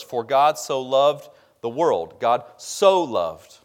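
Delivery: slow, weighty stress on "so" in "so loved". Shown as a demonstration. Preacher rereading the verse to the church, stress on "so". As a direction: lean hard on "so", drawing the phrase out. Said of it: "a lot of emphasis on this word".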